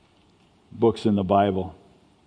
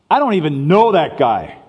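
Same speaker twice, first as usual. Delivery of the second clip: forceful denial; 5 semitones higher, 6 dB louder than usual. Retold or imitated. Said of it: "he goes from".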